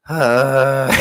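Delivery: high, strained croak; lost voice demo. Shown as a demonstration. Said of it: "Y'all ready to hear this shit?".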